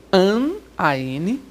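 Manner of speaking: An, A N spelled out letter by letter, slow; spelled out slowly. Shown as a demonstration. Teacher spelling out An, A N slowly to students. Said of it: "indica negação".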